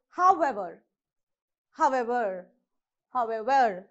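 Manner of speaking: however with weight on the ever part; howEVER, second part of however stressed. Shown as a demonstration. Teacher giving the example however, stress on ever. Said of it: "you have to stress on the ever part".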